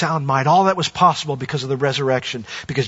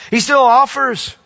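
climactic peak; 8 semitones higher, 5 dB louder, slower than usual. Acted. Climax of repeated offers.

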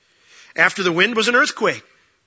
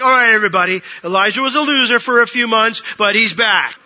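loud, raised public proclamation; mock announcement; 5 semitones higher, 9 dB louder than usual. Lord supposedly embarrassing Elijah before everybody, mock announcing. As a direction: announce it to the whole crowd, mockingly.

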